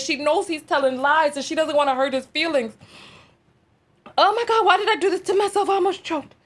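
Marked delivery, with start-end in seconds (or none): 4.2-6.5 high, loud, strained from laughing; real laughing fit, barely catching breath; choking laughter. Real, not acted. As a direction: gasp it out after nearly choking. Still recovering from laughing fit.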